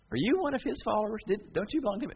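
put-on a servant girl singling him out; accusing girl.

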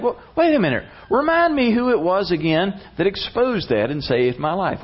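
high-pitched sudden realization; startled interruption. Retold or imitated. King stopping the chronicle reader, startled.

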